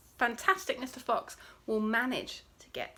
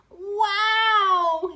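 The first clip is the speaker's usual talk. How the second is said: loud, high, drawn-out wow; amazed gasp; 8 semitones higher, 9 dB louder than usual. A narrator's reading. Smallest fox after a gulp of cider, amazed gasp. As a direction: let it burst out high and loud. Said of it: "he gasped".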